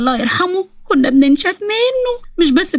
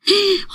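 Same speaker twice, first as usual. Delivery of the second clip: startled gasp; 7 semitones higher, 3 dB quieter than usual. Acted. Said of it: "خضتني".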